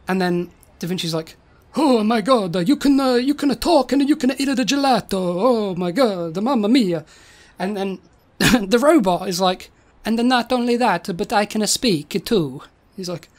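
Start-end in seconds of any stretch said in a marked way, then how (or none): 1.8-7.0 hammed-up, excitable Italian old man; amazed mock-Italian inventor. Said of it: "stereotypical Italian accents". 10.0-12.6 mock-Italian robot voice; cartoon Italian with added vowels. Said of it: "stereotypical Italian accents".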